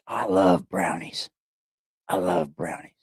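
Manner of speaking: soft, low, to oneself; hushed, low-pitched musing; low murmured aside. Real, not acted. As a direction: drop down and murmur it.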